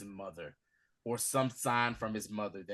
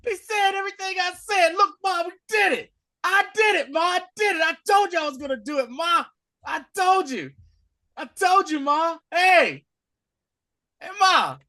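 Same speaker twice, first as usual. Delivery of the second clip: high, loud, ecstatic yelling; excited shouting to mom; 10 dB louder than usual. Retold or imitated. Son bursting in to show his mother, excited shouting. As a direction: shout it up to Ma like a thrilled kid. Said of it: "He's excited".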